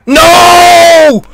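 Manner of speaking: high, loud, held cry; dismayed shout. Real, not acted. Viewer fearing for the hero, dismayed shout.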